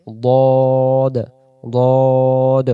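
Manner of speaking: slow, thick "দদ" with rounded mouth; Arabic dad in "দদ", emphatic and long; heavy dad. Shown as a demonstration. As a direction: stretch "দদ" out full and heavy. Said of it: "মোটা আওয়াজে মুখটা গোল হয়ে যাবে".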